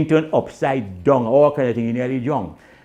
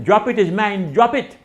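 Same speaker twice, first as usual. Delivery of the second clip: shouting; 8 semitones higher, 5 dB louder than usual. Retold or imitated. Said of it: "I tell him".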